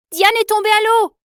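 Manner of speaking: very high, loud alarm cry; shouting overboard to the whole boat, high and loud. Acted. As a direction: scream the alarm across the boat. Alarm as Diane falls overboard.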